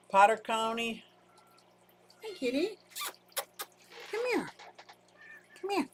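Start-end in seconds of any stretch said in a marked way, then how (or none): 2.2-5.9 high-pitched kitty call; calling a cat. Real, not acted. Calling kitty to come here, high pet voice. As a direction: coax the cat over in a tiny high voice.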